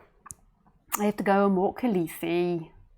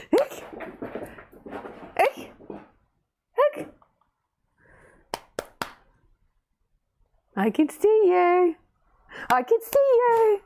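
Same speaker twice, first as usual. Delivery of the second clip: playful peekaboo voice; 10 semitones higher than usual. Real, not acted. Owner playing peekaboo with her dog, high teasing voice. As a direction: tease the dog in a squeaky peekaboo voice.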